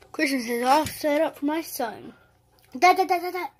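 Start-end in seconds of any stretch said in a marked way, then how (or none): 2.8-3.5 loud, high, eager calling; excited kid yelling for dad. Acted. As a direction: call for your dad over and over.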